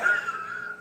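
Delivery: very high squealing laugh; a squeaky burst of laughter. Real, not acted. Laugh after Where's Bella.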